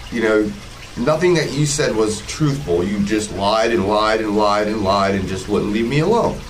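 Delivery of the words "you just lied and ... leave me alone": ordinary delivery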